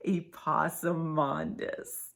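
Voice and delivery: ordinary delivery, nothing marked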